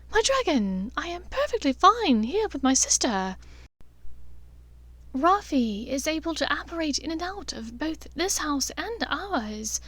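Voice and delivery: an elegant mother soothing her son; fond mother. A narrator's reading. Narcissa voiced by narrator.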